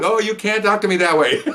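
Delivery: high mock outrage through a laugh; imitating offended woman. Woman indignant, teller laughing.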